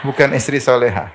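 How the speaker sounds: normal talking voice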